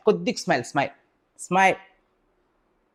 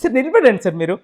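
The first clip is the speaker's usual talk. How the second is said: giggling through it; 5 semitones higher, 6 dB louder than usual. Acted. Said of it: "నువ్వెందుకు నవ్వుతున్నావే?".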